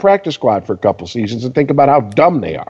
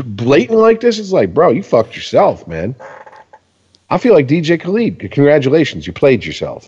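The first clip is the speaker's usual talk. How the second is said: ordinary talk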